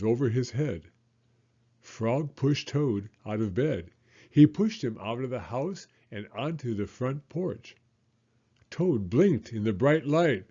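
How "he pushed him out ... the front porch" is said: ordinary delivery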